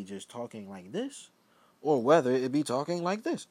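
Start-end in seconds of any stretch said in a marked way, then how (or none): 1.8-3.5 louder and higher, projecting; louder demo voice. Shown as a demonstration. Showing viewers how he turns up his voice, louder and higher. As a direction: raise the volume to show the contrast. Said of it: "the difference in the level of voice".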